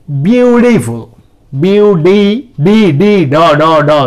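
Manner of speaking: "ब्यूटीफुल" with the T as a D, syllables drilled; American flapped T. Shown as a demonstration. Teacher drills the American D sound for the class. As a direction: drill the D in beautiful. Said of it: "साफ बोल रहा हूँ मैं".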